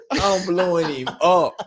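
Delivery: high laughing through the words. Real, not acted. A former player retelling his halftime texts, laughing high. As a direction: laugh as you tell it, drawing it out slowly.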